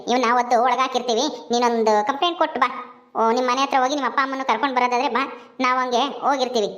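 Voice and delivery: an officer giving quick orders; stern policewoman. Acted. Policewoman role held. (ಗಡುಸಾದ police ಅಧಿಕಾರಿಯ ಧ್ವನಿ)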